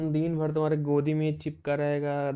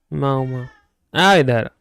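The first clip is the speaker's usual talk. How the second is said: louder call out to the cat; 8 dB louder, slower than usual. Real, not acted. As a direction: call the cat to come here. Calling the cat over. (बिल्ली को ज़ोर से पुकारना)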